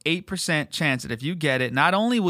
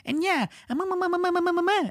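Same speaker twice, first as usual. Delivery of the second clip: very high mock babble; clueless debater. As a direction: mimic someone spewing nonsense in a high, silly voice. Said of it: "then you hear someone going".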